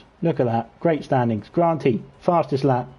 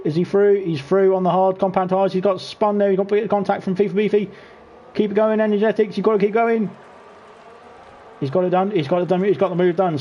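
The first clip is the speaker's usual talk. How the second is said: higher, eager calls on the overtake; excited commentary; 7 semitones higher than usual. Real, not acted. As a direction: cheer him through the move. Cheering an overtake live.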